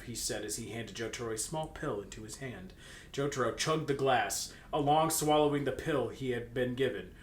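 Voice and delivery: usual speaking voice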